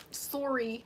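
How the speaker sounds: sorry drawn out with the open "sore" vowel. Shown as a demonstration. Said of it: "They be saying sore".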